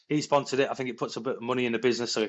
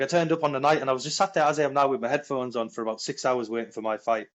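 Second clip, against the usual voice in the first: usual speaking voice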